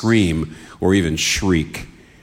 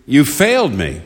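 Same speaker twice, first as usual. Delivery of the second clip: raised-pitch reproach, lightly acted; anguished accusation; 4 semitones higher than usual. Jesus cracking as he speaks to God, lightly acted anguished accusation. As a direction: accuse as if giving up hope. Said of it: "See, he's saying".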